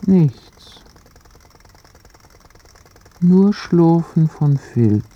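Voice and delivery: usual speaking voice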